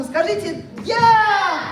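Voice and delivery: high, projected crowd prompt; rallying call. Pretend. Host gets the audience to answer together, rallying. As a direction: lift it high to get them answering.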